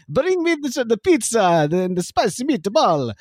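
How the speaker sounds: high hammed-up Italian accent; playful, exaggerated Italian accent starting very high; mock Italian accent. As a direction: order in a cartoon Italian accent. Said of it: "the accent".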